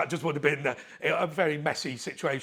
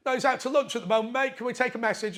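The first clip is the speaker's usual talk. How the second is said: quick, higher mock voice of a bored officer; imitating phone officer; 9 semitones higher, faster than usual. Officer answering the phone, mock high and quick. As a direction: rattle it off like a busy desk cop.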